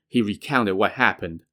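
plain reading voice

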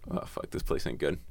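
hushed, breathy fear; scared whisper. Retold or imitated. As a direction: whisper it like you're afraid to be heard. Creeped out in dark tunnel.

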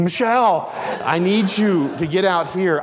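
high, urgent retold call; calling out for help. Husband calling his wife to take over, urgent. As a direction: summon her from another room, slightly panicked.

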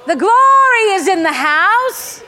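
high, loud, drawn-out preaching cry; exultant proclamation. Real, not acted. Preacher announcing glory to the congregation, exultant shout. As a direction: proclaim it to the back of the hall.

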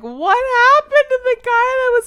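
shrill mock outrage at the character; indignant disbelief. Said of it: "I'm like".

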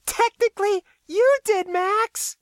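very high-pitched, teasing correction; KB amused. A narrator's reading. KB corrects Max about the blackmail, high and amused. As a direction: tease him in a high, merry voice. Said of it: "K B laughed".

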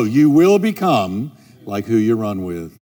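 real slow. Shown as a demonstration. Preacher repeats his key point to the congregation, real slow. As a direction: say it slowly and deliberately, dropping the pitch. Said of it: "I'm gonna say that real slow".

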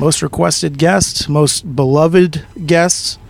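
slow, grand announcer voice; drawn-out host introduction; slow announcer hype. Acted. Host hyping guest.

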